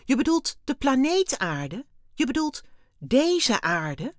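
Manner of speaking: high, loud disbelieving questions; incredulous. A narrator's reading. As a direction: check it in astonishment, slowing on the last words. Stunned boy checks what was meant. (ongelovig vragend)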